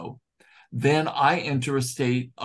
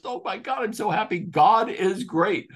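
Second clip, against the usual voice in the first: tearful joy; 6 semitones higher than usual. Retold or imitated. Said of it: "he cried. He had tears coming down his face. He says".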